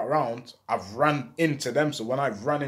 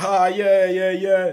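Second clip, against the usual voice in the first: laughing it off; 6 semitones higher, 7 dB louder, slower than usual. Retold or imitated. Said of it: "so I'm laughing like".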